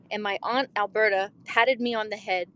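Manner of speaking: ordinary talk, nothing marked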